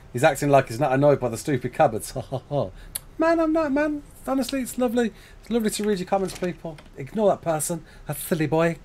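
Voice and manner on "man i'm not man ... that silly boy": mock sweet. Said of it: "He acts every time he records".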